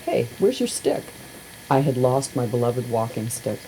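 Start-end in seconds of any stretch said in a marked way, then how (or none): 0.0-1.0 high, light quizzical voice; a friend's offhand question; Katz asking. Acted. Katz spots the missing stick, asks lightly. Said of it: "he arrived and immediately said".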